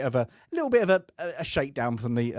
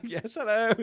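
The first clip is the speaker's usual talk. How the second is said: high-pitched mock posh British accent; prim and proper; 10 semitones higher than usual. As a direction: put on a prim posh English voice, half laughing. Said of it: "like, prim and proper".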